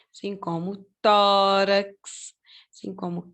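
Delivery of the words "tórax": "tórax" slowly with a long, strong TÓ; stressed syllable drawn out. Shown as a demonstration. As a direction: stretch the TÓ in "tórax". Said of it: "na penúltima sílaba".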